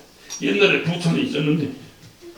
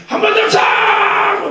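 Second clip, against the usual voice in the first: high, slow, shouted call to sing again; fervent shout; 12 semitones higher, 3 dB louder, slower than usual. Retold or imitated. Song leader urging the congregation, high shout. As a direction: rouse the room, stretched out. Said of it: "인도하는 사람이 충만하니까".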